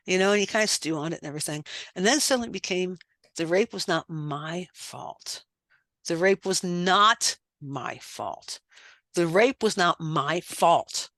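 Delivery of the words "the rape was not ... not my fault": repeating her mirror homework, slow and deliberate with building conviction; shifting emphasis. Retold or imitated. Slow growing conviction. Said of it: "started putting emphasis on different words".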